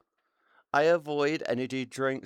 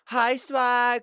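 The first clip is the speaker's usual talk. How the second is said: excited greeting; 10 semitones higher, 7 dB louder than usual. Real, not acted. Greeting a chatter.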